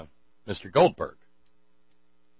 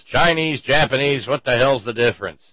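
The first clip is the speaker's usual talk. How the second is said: dismissive retort; 4 semitones higher, 7 dB louder than usual. Acted. [name] retorts dismissively in joke.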